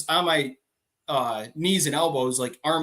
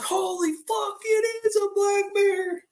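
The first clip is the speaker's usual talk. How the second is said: very high, drawn-out mock yell; shocked holler; slower than usual. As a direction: stretch it out in high-pitched alarm. Retold shock at seeing bear.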